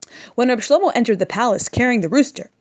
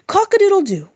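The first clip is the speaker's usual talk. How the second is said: rooster crow; 11 semitones higher, 5 dB louder than usual. Retold or imitated. Imitating rooster crow again.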